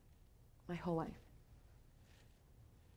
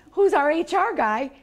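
usual speaking voice

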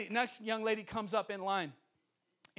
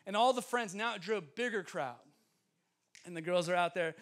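ordinary talk

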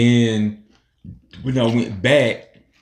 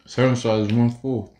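talking while snacking; speaking between bites; fry at the lips. Real, not acted. Seen 0: Speaker unclear; woman talks, then holds fry at lips, no pizza.

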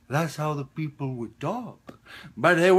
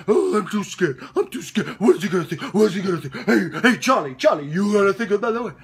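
high, loud whiny mimicry; mock the worriers in a frantic high voice; mocking scared actors; 8 semitones higher, 8 dB louder, faster than usual. Father fed up with nervous actors, mocking whine. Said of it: "I'm tired of these people going".

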